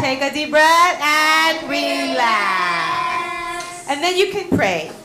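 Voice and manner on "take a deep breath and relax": drawn out, half sung, very slow; a slow, soothing "Take a deep breath and relax"; slow calming guidance. Shown as a demonstration. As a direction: guide the children into a calm breath. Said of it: "do your deep breathing".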